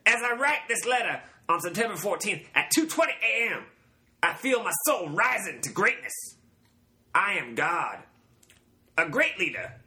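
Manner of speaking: high, swooping cult-leader proclamation; Charles Manson impression. Reading the note from the beginning, high Manson impression. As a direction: play the self-declared god, pitched high. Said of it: "you picture the Charlie Manson saying it".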